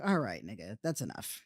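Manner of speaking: low, soft, fed-up mutter; muttered aside. Retold or imitated. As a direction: mutter it under your breath to cut him off. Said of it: "I was like".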